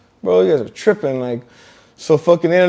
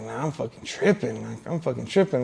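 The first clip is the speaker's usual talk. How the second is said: low, hushed inner monologue; self-doubting thought; 5 semitones lower, 10 dB quieter than usual. Retold or imitated. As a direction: talk yourself down under your breath. Retelling self-doubting thought to himself.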